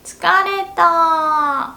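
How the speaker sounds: high, slow, sugary flirt voice; burikko cute voice.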